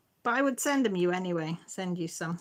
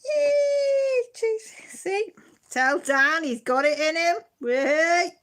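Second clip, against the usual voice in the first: high, loud delighted squeal; excited cheer; 10 semitones higher, 7 dB louder, much slower than usual. Real, not acted. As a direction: burst out cheering, much higher and louder. Cheering at a viewer's comment.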